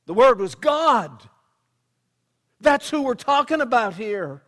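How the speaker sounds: loud, high preaching shout; emphatic proclamation. Real, not acted. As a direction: proclaim it at full force. Preacher drives home his point.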